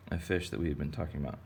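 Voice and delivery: ordinary talk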